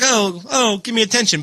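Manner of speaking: high whiny cat asking for attention; needy cat voice. Retold or imitated. Mocking a cat that wants attention, in a high whiny cat voice. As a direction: beg like a spoiled cat.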